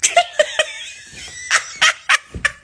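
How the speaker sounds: a high, loud put-on giggle; high girlish giggle. Giggle after kiss. (ضحكة بنوتية عالية)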